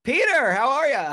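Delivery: loud, high mock-cheery hello; hearty phone greeting. Friend phoning Peter at a bad moment, hammed-up hearty greeting. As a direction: greet your buddy with hammed-up volume.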